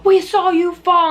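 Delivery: high, loud imitation of alarmed old ladies; mock-concerned old lady calling out slowly. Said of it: "These old ladies".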